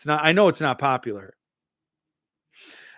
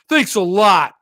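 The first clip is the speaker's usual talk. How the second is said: louder, slow sarcastic thanks with a laugh; 5 semitones higher, 8 dB louder than usual. Shown as a demonstration. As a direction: make the thanks drip with mock sarcasm. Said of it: "Does that sound sarcastic?".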